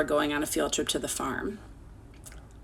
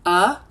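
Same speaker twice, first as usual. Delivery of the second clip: letters called out loud, one by one; spelling out letters; 7 dB louder than usual. Shown as a demonstration. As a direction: spell it out clearly for the class.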